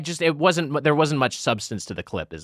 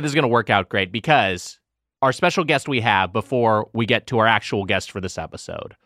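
usual speaking voice

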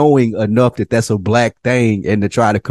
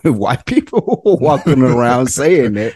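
laughing; 6 semitones higher than usual. Real, not acted. Laughing while picturing it.

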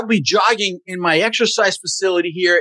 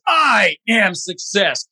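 "I" stretched out long, then falling; stress on I; much slower than usual. Shown as a demonstration. Coach demonstrating an affirmation, stress on "I". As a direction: lean hard and slowly on the word "I". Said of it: "you emphasize different words".

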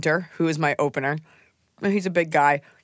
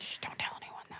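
whispering; 19 dB quieter than usual. Real, not acted. Hushing a secret.

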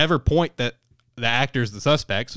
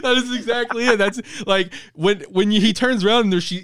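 higher, laughing through the words; giggling while explaining; real laughter bubbling up; laughing excitedly; 10 semitones higher, 5 dB louder than usual. Real, not acted. Laughing while describing funny scene.

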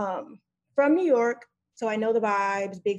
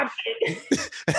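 laughing; 7 semitones higher than usual. Real, not acted. Caller cracking up at joke.

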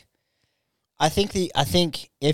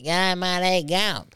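high, chirpy bloke voice; lightly hammed-up Aussie mate; mock Aussie bloke; 6 semitones higher, much slower than usual. Mocking a mate's greeting out the car window, high and chirpy.

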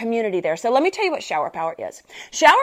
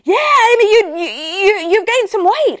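high, louder chiming in; teasing friend joins in; 10 semitones higher, 6 dB louder than usual. Retold or imitated. Jose joins in about her weight, high teasing voice. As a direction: pile on cheerfully. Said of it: "he's like".

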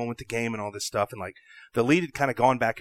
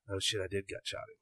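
dismayed, under breath; 4 semitones lower, 13 dB quieter than usual. Retold or imitated. Retold dismay, spoken low.

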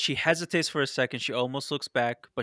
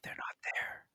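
whisper; 12 dB quieter than usual. Acted.

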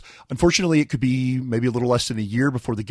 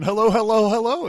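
catchphrase greeting; 9 semitones higher than usual. Acted. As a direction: lightly copy the host's greeting. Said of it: "That's better".

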